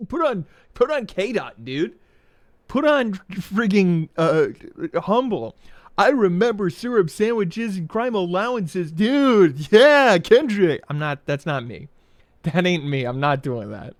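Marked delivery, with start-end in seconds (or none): 0.1-10.8 high, loud, over-excited fan; hammed-up dude hollering out a song request.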